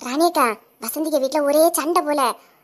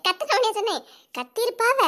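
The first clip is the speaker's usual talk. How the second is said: giggling through the words, a bit higher; laughing; 4 semitones higher, 3 dB quieter than usual. Acted. To her friend about the husband, laughing.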